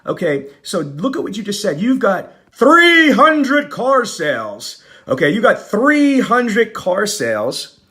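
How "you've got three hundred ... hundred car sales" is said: fired-up rant. Real, not acted. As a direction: preach it like a fed-up coach. Ranting at dealership numbers.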